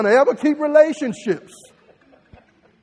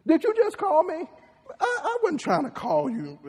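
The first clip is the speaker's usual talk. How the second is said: acted spat; 7 semitones higher, 3 dB quieter than usual. Acted couple quarrel.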